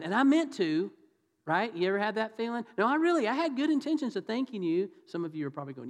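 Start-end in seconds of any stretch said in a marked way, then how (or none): 2.8-4.9 high-pitched, insistent protest; higher, defensive excuse-making. Acted. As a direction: plead your good intentions, voice pitched up. Acted excuse for not thanking.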